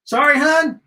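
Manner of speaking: high, loud, puzzled server; puzzled American server. Acted. American server mishears her order.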